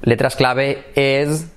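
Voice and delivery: ordinary talk, nothing marked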